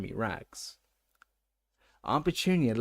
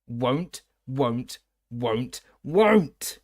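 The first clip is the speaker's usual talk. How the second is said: elf rising to a scream; 6 dB louder, much slower than usual. A narrator's reading. The elf refusing Harry, building from croak to scream. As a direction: build from grumble to shriek. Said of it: "voice had risen to a scream".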